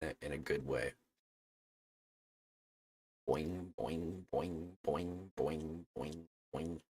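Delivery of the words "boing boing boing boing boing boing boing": bouncy boing noises. Retold or imitated. Imitating bouncing physics.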